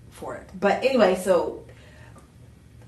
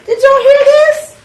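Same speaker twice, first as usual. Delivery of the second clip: loud, high, laughing call to the audience; thrilled, laughing announcement; excited shout; 14 dB louder than usual. Real, not acted. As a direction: shout it out with glee. Host excited by co-host's date.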